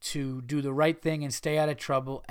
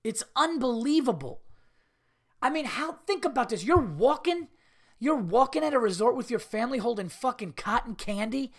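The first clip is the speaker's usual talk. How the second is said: frustrated screaming rant; 5 semitones higher than usual. Real, not acted. Comedian ranting at his podcast audience, yelling in frustration. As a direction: scream it out like a fed-up dad who can't believe it. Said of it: "I know I'm screaming here".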